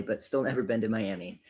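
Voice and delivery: usual speaking voice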